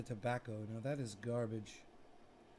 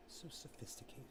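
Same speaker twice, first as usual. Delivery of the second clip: soft unvoiced whisper; 14 dB quieter than usual. Showing off to viewers, whispered aside. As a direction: breathe it to yourself.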